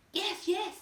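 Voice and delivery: high, eager voices together; all agree at once; eager chorus. A narrator's reading. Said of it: "they chorused".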